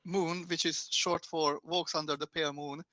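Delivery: usual speaking voice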